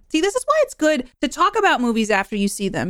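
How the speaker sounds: normal talking voice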